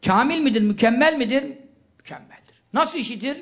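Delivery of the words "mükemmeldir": breathy whispered answer to himself. Real, not acted. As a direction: drop to a bare whisper to confirm. Answering own question softly.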